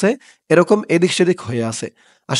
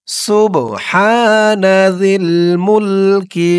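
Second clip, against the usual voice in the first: slow, drawn-out chanted recitation of the dua; slow Arabic recitation; 2 semitones higher, much slower than usual. Shown as a demonstration. Teacher recites the dua slowly for viewers, chanted. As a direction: recite each word long and even, like prayer.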